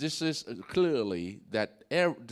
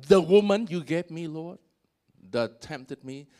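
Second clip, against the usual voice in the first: blaming Adam; 3 semitones higher, 7 dB louder than usual. Retold or imitated. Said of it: "Adam say, you know, to Eve".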